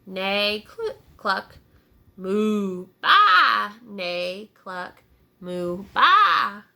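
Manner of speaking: neigh, cluck, moo and baa in turn; a string of barnyard calls; animal noises. Acted. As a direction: make each farm animal's call. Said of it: "it probably would have sounded like".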